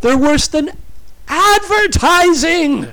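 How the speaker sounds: high, loud outraged shout; furious, shrill-pitched outburst; angry snarl. A narrator's reading. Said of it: "she snarled".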